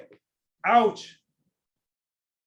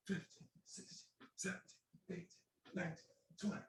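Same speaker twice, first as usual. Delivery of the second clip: breathless whispered counting under effort; strained whisper counting; 20 dB quieter than usual. Real, not acted.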